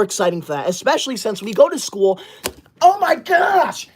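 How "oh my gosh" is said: high, slow, shocked oh my gosh; a high, drawn-out startled cry; shocked gasp. Acted. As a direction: recoil as if something landed on you. Shocked by a gift on her head.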